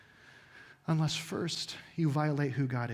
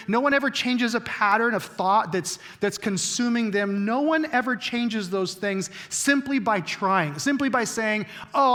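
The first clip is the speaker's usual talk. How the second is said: raised, driving pulpit voice; fervent preaching; 5 semitones higher, 6 dB louder than usual. Acted. Pastor drives the quote home to his congregation, fervent preaching. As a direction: preach it out over the room.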